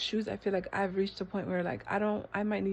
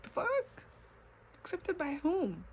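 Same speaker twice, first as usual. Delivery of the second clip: high-pitched disbelief; baffled, high rhetorical challenge; incredulous; 8 semitones higher than usual. Real, not acted. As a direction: question it high, as if absurd. Scornful disbelief at seeking acceptance.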